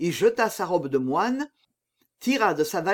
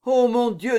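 higher, distressed appeal to God; anguished cry; 5 semitones higher, 4 dB louder than usual. A narrator's reading. Dying executioner calls on God, anguished cry. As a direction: cry out in dread.

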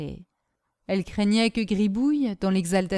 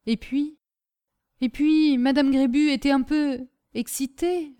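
lightly voiced timid maid, higher-pitched; halting, careful explanation in a higher voice; hesitant servant Caroline; 6 semitones higher, 3 dB louder than usual. Servant searches for tactful words before her master, hesitantly.

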